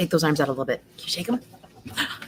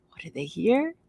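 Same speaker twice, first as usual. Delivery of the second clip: plain reading voice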